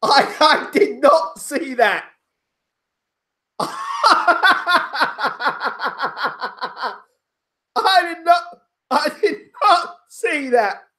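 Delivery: real incredulous laughter; laughing disbelief. Real, not acted. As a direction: laugh through the words, unable to believe it. Laughing at shock result.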